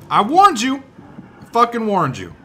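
high, loud I-told-you-so; indignant shout. Pretend. Player after shooting the enemy he warned, indignant shout. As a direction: yell it in mock outrage.

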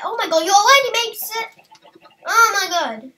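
loud, fast squeal of delight; excited. Real, not acted. Finding eight eggs in the game, loud fast excitement. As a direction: burst out with joy at the find.

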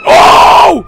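loud, high yell of fright; sudden piercing shout; startled scream. Real, not acted. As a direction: scream out at a jump scare. Jump scare in the game.

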